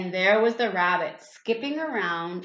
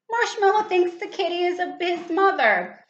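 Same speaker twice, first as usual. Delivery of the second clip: delighted; 9 semitones higher, 4 dB louder than usual. Real, not acted. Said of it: "explains to child".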